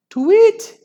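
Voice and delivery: high eerie owl tweet; eerie owl call. Acted. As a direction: whistle it like a hunting owl. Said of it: "an eerie wavering call sounded".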